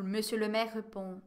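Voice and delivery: ordinary talk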